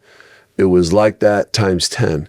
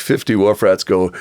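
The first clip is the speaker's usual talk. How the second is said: ordinary delivery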